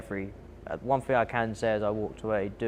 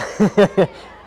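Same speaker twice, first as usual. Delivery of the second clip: big laugh, high and loud; loud burst of laughter; 10 semitones higher, 11 dB louder than usual. Real, not acted. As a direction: laugh out at the memory. Laughing at own hands dropping.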